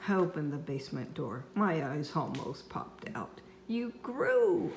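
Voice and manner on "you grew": shocked yell. A narrator's reading. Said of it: "I yelled".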